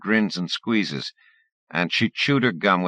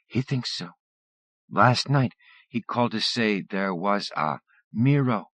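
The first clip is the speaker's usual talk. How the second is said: Fam's voice; 5 semitones higher than usual. Acted. Fam tells about the mirror.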